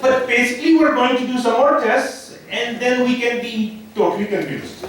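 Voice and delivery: a lightly hammed-up Indian doctor giving a diagnosis; Indian doctor accent. Comic playing an Indian doctor explaining test results to a patient. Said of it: "Indian doctors".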